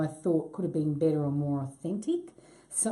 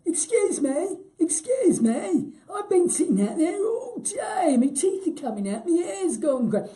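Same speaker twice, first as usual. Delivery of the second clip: put-on high, loud comic old-timer's complaint; strange old character; 10 semitones higher, 5 dB louder than usual. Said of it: "there were these two old guys".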